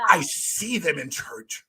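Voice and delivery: slow, drawn-out mock disapproval; judgmental mimicry. Coach shows host how she sounded, mock-judgmental. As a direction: imitate someone judging, stretching each word. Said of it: "Listen to how you said it".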